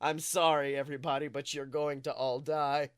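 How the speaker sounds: ordinary talk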